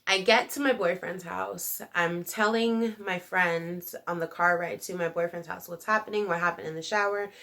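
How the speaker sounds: ordinary talk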